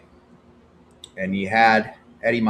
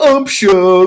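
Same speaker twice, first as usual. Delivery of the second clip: mock heckle; 10 semitones higher, 10 dB louder than usual. Repeated heckle.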